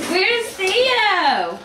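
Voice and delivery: high, drawn-out peekaboo reveal; playful sing-song. Real, not acted. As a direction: play peekaboo. Peekaboo with son in cupboard.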